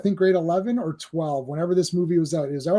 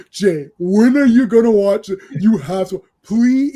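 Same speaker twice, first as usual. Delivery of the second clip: begging; 2 semitones higher, 7 dB louder than usual. Retold or imitated. Classmate badgering Jay to watch the film, begging. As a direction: plead with mounting desperation, imitating a classmate. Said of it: "he was begging me".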